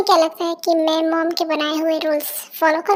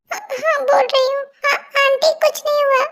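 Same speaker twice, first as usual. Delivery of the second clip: high voice calling back reassurance; hurried-to-cover-up, high reply; flustered compliance; 6 semitones higher than usual. Acted. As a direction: give in and answer, pitched high. Pressured girl reassuring aunt through door.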